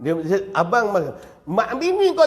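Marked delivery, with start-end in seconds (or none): none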